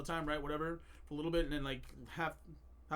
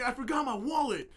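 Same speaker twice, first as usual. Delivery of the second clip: loud, higher imitation of exasperation; retold outburst; 6 semitones higher, 7 dB louder than usual. Retelling brother's laundry outburst, loud mock exasperation. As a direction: exclaim it as the upset brother. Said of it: "he started just cussing".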